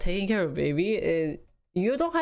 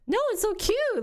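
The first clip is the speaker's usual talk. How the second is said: retold protest. Her own reply to grandma.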